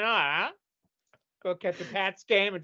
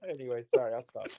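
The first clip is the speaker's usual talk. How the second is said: ordinary delivery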